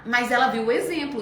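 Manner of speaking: usual speaking voice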